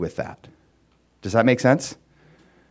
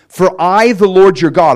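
emphatic proclamation; 7 semitones higher, 9 dB louder than usual. Acted. Reading God's words in scripture.

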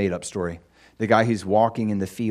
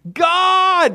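much higher, loud cry to God; desperate scream; 9 dB louder than usual. Retold or imitated. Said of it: "And he's screaming".

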